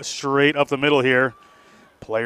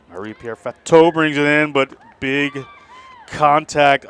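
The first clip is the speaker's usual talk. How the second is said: louder match call, building; live play-by-play; 3 semitones higher, 8 dB louder than usual. Real, not acted. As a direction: call the attack as it happens.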